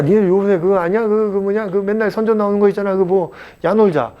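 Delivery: high-pitched, gushing mock boast; acted bragging. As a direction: boast excitedly about a famous former student. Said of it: "내가 이제 자랑하는 거지".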